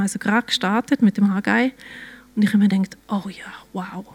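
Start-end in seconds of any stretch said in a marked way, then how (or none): 3.1-4.0 very soft, almost whispered wonder; hushed awe. Retold or imitated. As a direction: breathe it quietly to yourself, awed. Retold awed thought to herself. (leises, ehrfürchtiges Staunen)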